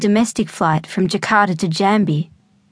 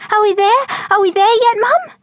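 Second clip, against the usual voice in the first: put-on much higher, eager kid squeal; excited; 13 semitones higher, 3 dB louder than usual. A narrator's reading. Excited girl pestering her mum on arrival. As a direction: bounce with impatience, voice way up. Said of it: "in excitement".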